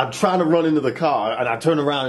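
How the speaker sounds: usual speaking voice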